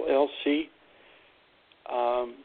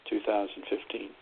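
ordinary talk, nothing marked

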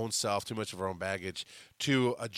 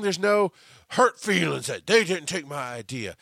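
usual speaking voice

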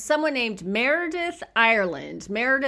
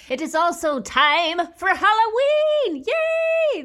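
excited; 11 semitones higher, 7 dB louder, slower than usual. Real, not acted. Excited Halloween announcement.

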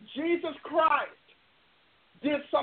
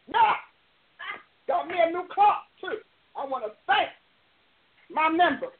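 ordinary talk, nothing marked

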